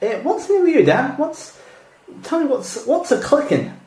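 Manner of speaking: high, puzzled question; high, puzzled question about a clicking. Real, not acted. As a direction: ask about the odd clicking noise. High, surprised at a noise.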